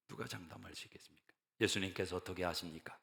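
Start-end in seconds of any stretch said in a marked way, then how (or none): 0.1-1.3 softer and faster, near a whisper; faster, hushed rhetorical question; quiet and quick. Acted. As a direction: murmur the challenge quickly. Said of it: "repeat, faster and softer".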